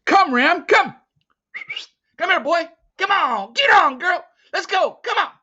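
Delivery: high, loud calls; shouting to the wolf dog. Acted. Teo calling his wolf dog to flee, high loud shouts. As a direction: yell for the dog to come now.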